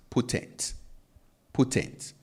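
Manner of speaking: potent said with a pure oo-like O, no glide; Ghanaian pronunciation. Shown as a demonstration. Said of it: "the average Ghanaian will pronounce this as".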